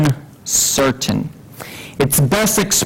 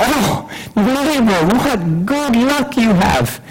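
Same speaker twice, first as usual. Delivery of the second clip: envious neighbor; 6 semitones higher, slower than usual. Acted. Neighbor drawn-out envy.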